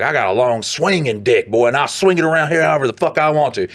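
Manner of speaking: a hint of a swaggering manager; eccentric plant manager. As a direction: brag like a cocky boss in a meeting. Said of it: "very eccentric guy, in a meeting, he'd be like".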